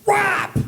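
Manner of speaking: high yelped rimshot noise; quick high mock drum hit; rimshot sound. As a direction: punch the joke with a mouth rimshot. Punchline sound effect.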